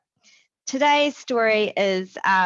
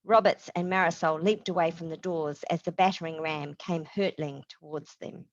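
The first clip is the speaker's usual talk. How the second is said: plain reading voice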